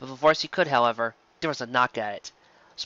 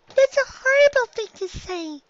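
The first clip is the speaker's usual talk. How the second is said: put-on much higher, slow child's voice; reproachful filly; 5 dB louder than usual. A narrator's reading.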